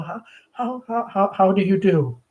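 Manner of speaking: nervous stammered greeting; stiff, trembling. Acted. Shy girl greeting her brother's friend, stammering nervously. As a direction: stumble over the words in fright. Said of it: "stiff and trembling".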